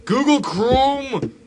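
high, loud, drawn-out yelp; startled shout. Real, not acted. Player scared by the game, startled shout. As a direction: blurt it like a curse in fright. Scare, blurts a stand-in curse.

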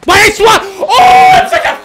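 high, loud cry rising to a shriek; loud outburst of dismay; anguished shout. Real, not acted. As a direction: shout it, then shoot up high. Cries out at the play.

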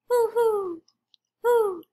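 high, loud owl hoots; owl hooting. Acted. Mister Owl greets Quincy with high hoots. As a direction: hoo like a friendly desert owl.

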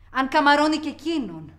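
yelled at the mother; shouted accusation. Acted. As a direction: throw it in her face.

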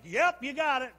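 loud, high boastful crowing; Herod. Acted. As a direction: proclaim yourself proudly to the crowd. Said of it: "old Herod was like".

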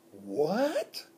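high, rising astonished squeak; shocked squeak. Acted. Hamster hears about paper wads, shocked high squeak. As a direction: squeak in disbelief. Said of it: "I squeaked".

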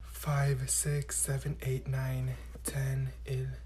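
barely audible count under the breath; whispered counting. Acted. Counting on fingers during quiz.

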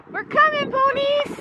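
high-pitched, playful call across the barn; high sing-song call. Real, not acted. Calling out to the ponies.

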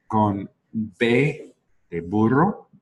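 "burro" with lips closed, slow b; slow demo of "burro" with a firm b. Shown as a demonstration. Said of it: "sus labios siempre son cerrados".

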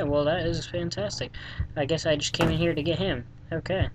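normal talking voice

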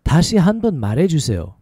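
syllables joined into one flowing phrase. Shown as a demonstration. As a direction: run it together at conversational speed. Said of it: "A little faster".

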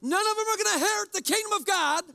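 high, loud and fast, looking down his nose; self-righteous proclamation. Shown as a demonstration. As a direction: proclaim it like a smug Pharisee judging the crowd. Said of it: "Look down your nose".

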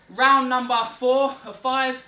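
loud, drawn-out round call; coach callout. Real, not acted. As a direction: announce the round like a host. Coach calls the next round.

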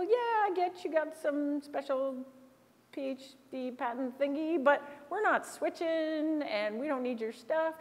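politely telling them to get out; dismissive exec. Retold or imitated. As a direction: executive telling the salespeople to leave, mock-polite. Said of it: "put up her hand and say".

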